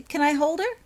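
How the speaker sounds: put-on high, loud eager little girl; small girl pleading to hold the kitten; eager child plea. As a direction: beg to hold it, all excitement.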